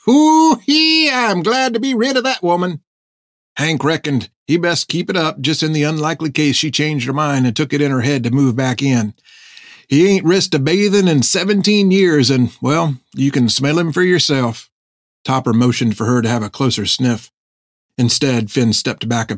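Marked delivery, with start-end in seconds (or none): 0.1-2.8 put-on cackling old hermit. Hank whoops about wife. 3.6-14.7 put-on old sailor voice. Topper explains Hank.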